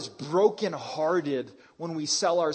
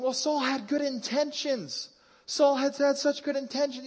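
whiny objector; 9 semitones higher than usual. Retold or imitated. Imagined listener defending Saul.

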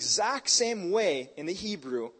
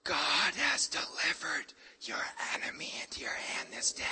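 whispered; 3 semitones lower, 9 dB quieter than usual. Acted. Abishai to David beside the sleeping Saul, whispering. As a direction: whisper it like a secret in enemy camp. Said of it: "it'd be more like this".

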